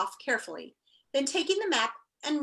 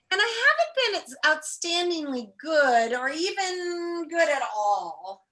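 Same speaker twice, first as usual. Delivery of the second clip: slow, mournful, higher whine; gloomy whine; 5 semitones higher, 4 dB louder, slower than usual. Acted. Milo moping to himself about not being good, slow whine. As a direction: drag it out like a sulky boy. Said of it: "he said".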